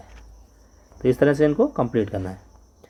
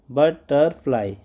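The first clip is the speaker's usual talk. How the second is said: slow, drawn-out butterfly; say aloud; much slower than usual. Shown as a demonstration. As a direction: stretch out butterfly syllable by syllable. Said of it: "allowed जोर से इसको बोलना है".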